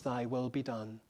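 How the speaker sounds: very soft and low; the son quietly giving in to the father; hushed surrender. Acted. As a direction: breathe it out in quiet submission.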